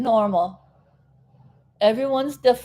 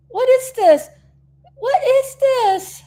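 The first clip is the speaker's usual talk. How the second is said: high, drawn-out incredulous cry; what is this, said high and slow; mock outrage; 13 semitones higher, 4 dB louder than usual. Real, not acted. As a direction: squeal it in disbelief. Mock outrage at product seasons.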